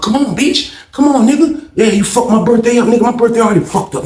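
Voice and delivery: high, heated goading; worked-up mock challenge, pitched high; retold fight taunt. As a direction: act out daring him to fight.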